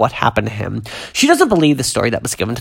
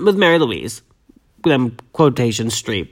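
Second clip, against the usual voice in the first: normal talking voice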